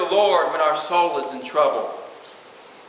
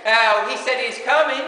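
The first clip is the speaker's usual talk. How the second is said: higher, scoffing jeer; mocking taunt; 6 semitones higher than usual. Scoffers mock believers waiting for God's return, pitched high and jeering. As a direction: sneer it like a scoffer. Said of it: "that is the taunt of the enemy".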